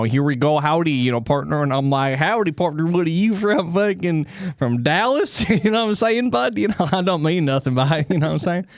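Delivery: folksy Canadian with Texas howdy, rising pitch; Texan-Canadian accent. Acted. Canadian in Texas quoting cowboy greetings, mixed accent. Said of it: "mix Texas with Canada".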